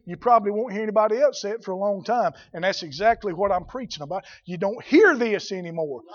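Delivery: ordinary talk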